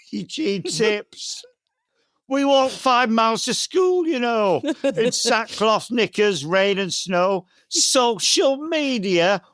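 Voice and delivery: put-on northern accent. Comedian reading his public information poem for bonfire night, northern accent. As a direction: recite nostalgic verse in a northern accent. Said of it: "do it in a northern accent".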